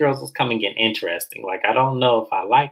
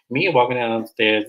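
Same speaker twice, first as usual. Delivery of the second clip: ordinary delivery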